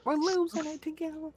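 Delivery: squeaky silly voice; squeaky high silly voice. Pretend. Silly playful reply.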